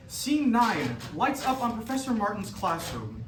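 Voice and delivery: ordinary talk, nothing marked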